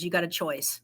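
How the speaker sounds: ordinary talk, nothing marked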